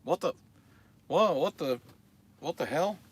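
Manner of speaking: mock-confused high voice; confused Emery. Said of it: "he was all confused and he's like".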